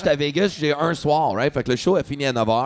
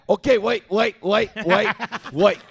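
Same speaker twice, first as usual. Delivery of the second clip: calling after; 5 semitones higher, much slower than usual. Retold or imitated. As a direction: call him back as he walks away. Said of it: "il me crie après". (crier après quelqu'un qui s'éloigne)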